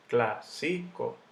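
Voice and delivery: clásico split slowly as clá-si-co; syllable by syllable. Shown as a demonstration. Teacher sounding out the word for students, slow syllable by syllable. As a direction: break clásico into three separate syllables. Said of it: "Yo digo clásico".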